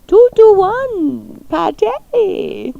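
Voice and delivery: high, drawn-out put-on voice; playful high-pitched announcing; high playful call-out. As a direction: stretch it out high, like a hammed-up call.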